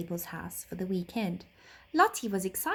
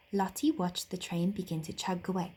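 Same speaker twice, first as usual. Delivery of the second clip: ordinary delivery, nothing marked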